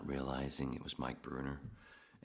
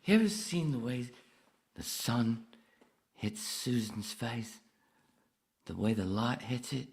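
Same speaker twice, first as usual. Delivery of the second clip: higher, halting put-on voice; acted movie character; 7 semitones higher, 5 dB louder than usual. Film character musing about Susan's face, higher acted voice. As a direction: muse it higher, in separated phrases. Arthur impression, movie dialogue.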